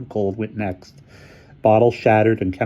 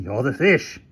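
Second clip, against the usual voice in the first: character voice; 5 semitones higher, slower than usual. A narrator's reading.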